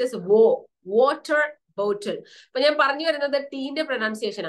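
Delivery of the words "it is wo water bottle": "water bottle" said slowly with a British "wo" and a crisp t; teacher models "water bottle" for students, stretched out and exact; correct pronunciation demo. Shown as a demonstration. Clear model of "water bottle", with "water" rising. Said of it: "ഡബ്ല്യു എ വന്ന വ എന്നല്ല".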